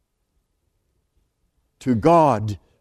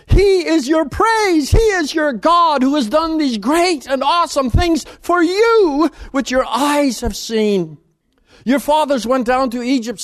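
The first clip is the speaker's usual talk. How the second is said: much higher and louder, ecstatic praise; exultant proclamation; 10 semitones higher, 4 dB louder than usual. Acted. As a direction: cry it out in wonder. Preacher proclaims God's greatness.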